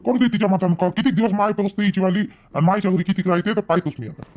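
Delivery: usual speaking voice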